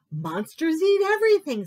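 high, loud alarmed duck; duck's cry. A narrator's reading. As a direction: cry it out in dismay. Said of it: "said Duck with a cry".

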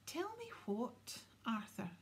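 high, slow, menacing demand; dangerous. A narrator's reading. Wife pressing her evasive husband, dangerous voice. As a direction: repeat it as a drawn-out warning. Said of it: "in a dangerous sort of voice".

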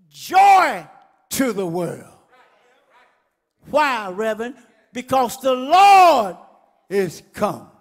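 loud, rising sermon cry; full-force preaching. Acted. Preacher stirring the congregation, loud and fierce. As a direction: preach it hard to the crowd. Preaching climax, shouted lyrics.

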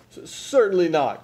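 loud, high, stammered denial; crying out. A narrator's reading. Frizzle denies having a baby ghost, crying out. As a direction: stumble on the start, then cry it out. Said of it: "cried Frizzle".

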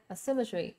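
weight on SYM in "asymmetry", falling tail; second-syllable stress. Shown as a demonstration. Teacher confirms the answer for students, second-syllable stress. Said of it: "âm số hai ha".

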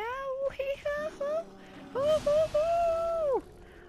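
very high "ohoho" laugh; falsetto giggle. Real, not acted. Gamer laughs at his clumsy sign-off, high giggle. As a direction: burst into a squeaky laugh. Laughs at own bumbling outro.

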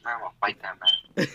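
usual speaking voice

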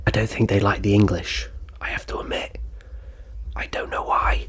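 whispered aside, as if confiding; hushed confession. Pretend. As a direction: drop into a secretive whisper. Mock confiding aside about the French.

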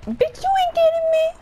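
very high, loud put-on voice; mocking enemy's taunt. Player voicing the opponent who escaped, high mocking taunt. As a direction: squeal it as a cocky taunt. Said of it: "He was like".

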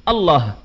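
الله said short with the long a dropped; clipped الله with no long vowel; mispronounced Allah. Shown as a demonstration. Preacher demonstrates the forbidden short Allah, clipped. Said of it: "شو صار اللفظ".

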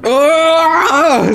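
squealed ah; high mock scream. Player voicing a character's high yelp. Voiced cry.

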